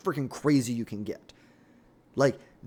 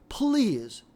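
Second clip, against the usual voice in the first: sarcastic pleading; 7 semitones higher than usual. Acted. Sarcastic prayer for Omar nomination.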